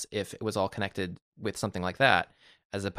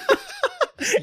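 high squeal laugh; 4 dB louder than usual. Real, not acted. Laughing at slurp bit.

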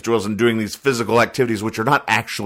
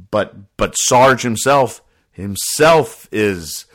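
usual speaking voice

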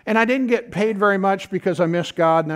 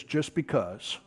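quiet answer; 5 semitones lower, 10 dB quieter, slower than usual. Retold or imitated. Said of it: "He says".

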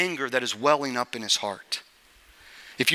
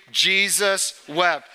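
drawn out slowly, eyes closed, reverent; slow solemn recital; much slower than usual. Shown as a demonstration. Pastor has the congregation close their eyes and say it slowly. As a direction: let each word hang, very slow. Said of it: "Close your eyes and say the verse".

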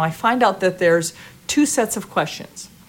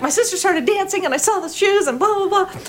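much higher, eager and bubbly; high excited chatter; 12 semitones higher than usual. Retold or imitated. Dancer gushing to the panel, high and eager. Retelling her excited answer.

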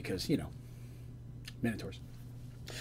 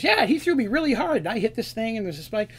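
higher, bubbly storytelling voice; excited kender; 8 semitones higher, 4 dB louder than usual. Acted. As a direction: rattle it off, thrilled by the memory. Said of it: "And she goes".

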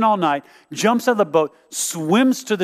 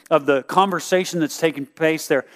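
usual speaking voice

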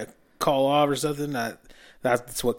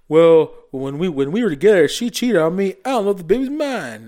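higher-pitched mock guest testimony; talk-show guest; 5 semitones higher, 4 dB louder than usual. Man on Maury profile doubting the baby, mock guest. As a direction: lightly imitate an aggrieved guest telling their side. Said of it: "they do these things like profiles".

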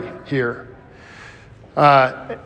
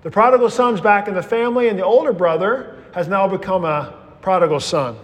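normal talking voice

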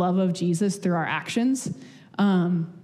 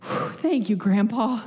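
put-on relieved kid; 4 semitones higher, slower than usual. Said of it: "And I was like".

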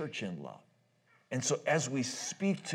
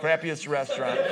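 ordinary talk, nothing marked

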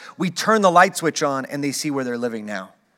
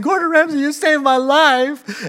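mock tearful gratitude; 9 semitones higher than usual. Restaurant staff thanking the chef, high mock crying. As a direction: wail your thanks, hammed up. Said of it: "everybody is crying and hugging each other".

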